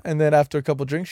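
ordinary delivery

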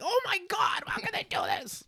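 shrill, very high mock outrage; mocking outraged voice. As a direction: squeal it like a scandalized onlooker. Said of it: "it was like".

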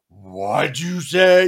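loud, slow confrontation; mock menacing demand; imitated offended woman. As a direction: draw it out as a loud challenge. Insulted big woman turns to confront.

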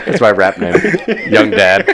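said through real laughter; cracking up mid-joke; laughing hard at own rap-name joke. Real, not acted.

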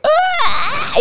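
mock cry. Imita llanto repentino.

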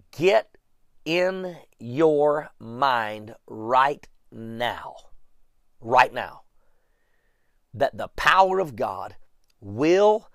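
slow, spaced-out emphasis with long pauses; really slow. Real, not acted. Preacher urging listeners to get this in their minds, really slow. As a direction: measure it out word by word. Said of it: "say that really slow".

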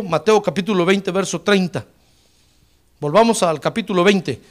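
ordinary delivery

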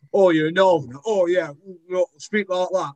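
hammed-up mock Northerner, pitched high; over-dramatized Northern accent. Actor mimicking people's bad impressions of Northerners, over-dramatized Northern accent. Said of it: "they're always over-dramatized versions".

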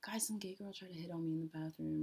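hushed, secretive whisper to friends; confiding whisper. Retold or imitated. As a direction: whisper it like fresh gossip.

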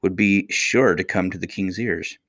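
usual speaking voice

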